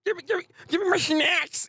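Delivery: high, put-on monster voice; a spoiled beast demanding snacks; greedy devil demanding food. Devil appears on the street demanding snacks, high greedy voice. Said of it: "He said".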